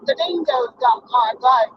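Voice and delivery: attempted Australian accent, overdone. Shown as a demonstration. Showing off to her doubting listener, exaggerated Australian accent. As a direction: put on a hammed-up Australian accent. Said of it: "for my Australian".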